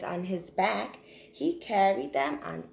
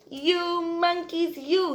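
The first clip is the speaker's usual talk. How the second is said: higher, slow scolding; 8 semitones higher, 4 dB louder, slower than usual. Acted. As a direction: wag a finger and scold. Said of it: "This made the peddler angry".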